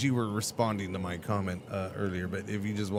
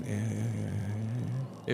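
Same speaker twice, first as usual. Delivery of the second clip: ordinary talk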